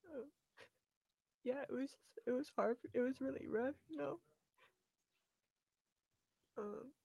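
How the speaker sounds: real grief pushing the voice up; getting emotional. Real, not acted. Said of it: "sorry, I might get emotional".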